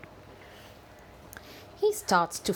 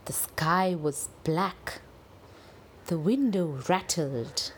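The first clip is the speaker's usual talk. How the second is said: ordinary delivery, nothing marked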